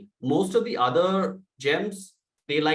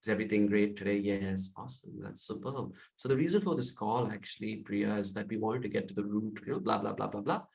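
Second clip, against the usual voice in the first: speaking very calm; 5 semitones lower, 6 dB quieter than usual. Shown as a demonstration. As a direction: bring it right down and soothe them. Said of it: "So then I speak very calm".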